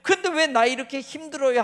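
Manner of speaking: a believer crying out in pain to God; anguished complaint to God. Acted. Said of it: "하는 때가 있잖아요".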